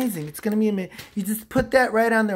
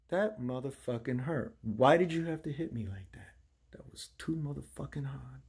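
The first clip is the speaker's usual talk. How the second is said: low, soft grumbling; hurt muttered complaint; 6 semitones lower, 10 dB quieter than usual. Acted. Host complaining about being hit too hard, low muttering. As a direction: grumble it under your breath.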